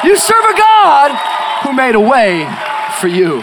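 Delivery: loud sermon building to high, stretched-out shouts; full-force preaching. Real, not acted. Preacher rousing the evening congregation to joy, full-force fervour. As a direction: rouse the crowd with mounting fervour.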